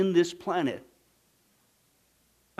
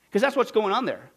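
usual speaking voice

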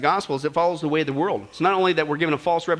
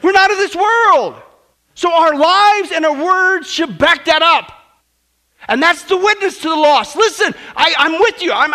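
loud sermon building higher and louder; impassioned preaching; 13 semitones higher, 11 dB louder than usual. Acted. Preacher tells church they are not of this world, impassioned preaching. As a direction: drive each phrase home to the congregation.

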